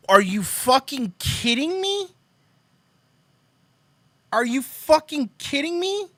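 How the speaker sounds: can't believe what he just read; disbelief. Real, not acted. Reacting to the orange Castro tweet, a high disbelieving shout.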